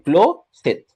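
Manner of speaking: كلوست pronounced clearly as klo-set; syllable split. Shown as a demonstration. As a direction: say كلوست slowly in two beats. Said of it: "قطع عينك".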